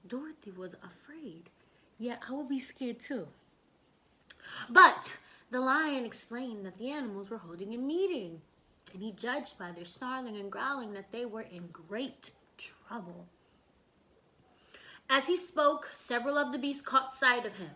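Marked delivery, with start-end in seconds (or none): 0.1-1.5 unvoiced fearful whisper. A narrator's reading. Whispered fear line in story. 8.9-13.3 unvoiced hushed narration; whispered. A narrator's reading.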